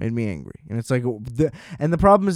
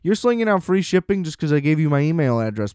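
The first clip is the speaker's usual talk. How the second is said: normal talking voice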